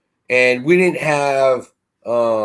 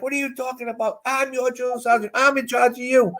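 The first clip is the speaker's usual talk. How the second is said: a lightly voiced big drill sergeant, pitched higher; 10 semitones higher, 4 dB quieter than usual.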